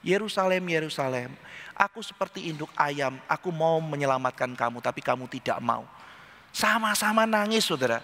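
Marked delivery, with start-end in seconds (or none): 0.1-5.8 low, subdued sorrowful address; restrained weeping lament. Acted. Jesus grieves over Jerusalem refusing rescue, restrained lament. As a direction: grieve quietly, pitched down, with no wailing. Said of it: "kenapa Yesus menangis?".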